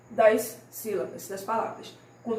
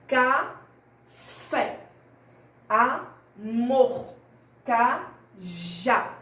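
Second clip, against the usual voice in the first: "Café, amor, cajá" split, FÉ, MOR, JÁ forceful; final-syllable stress; 3 dB louder, much slower than usual. Shown as a demonstration. Teacher shows the last syllable is strongest, final-syllable stress. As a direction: pause inside each word, then lean hard on FÉ, MOR, JÁ. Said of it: "A última sílaba que eu pronuncio com mais intensidade".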